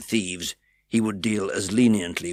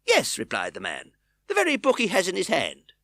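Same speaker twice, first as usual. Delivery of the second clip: eager reply; 10 semitones higher, 4 dB louder than usual. A narrator's reading. Bookseller eager.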